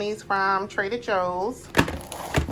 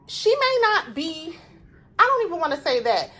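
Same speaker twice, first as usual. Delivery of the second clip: heated, raised-pitch venting at TV pundits; annoyed rant; 5 semitones higher than usual. Real, not acted. Vlogger venting at TV commentators about the first lady, heated and high. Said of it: "people just piss me off".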